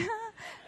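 high, soft laugh; a small laugh at your own joke; giggle. Real, not acted. Speaker joking that she obviously lived, giggling.

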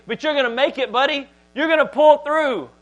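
high, rousing buddy encouragement; mock pep talk. Preacher voicing what Jesus doesn't say, mock cheerleading.

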